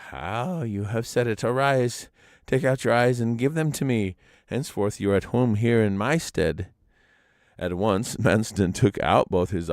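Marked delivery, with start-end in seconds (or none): 0.1-6.7 lightly voiced wise grandfather; old man replying. A narrator's reading. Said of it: "old man grants wish".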